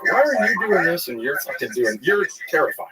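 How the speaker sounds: put-on high-pitched child pleading with parents; mock frightened protest. Child protesting parents' discipline, retold.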